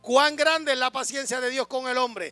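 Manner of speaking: loud, steady pulpit preaching; preaching at full force. Acted. Pastor preaching Abraham's promise to the congregation, loud and fervent. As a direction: proclaim it to the church with conviction.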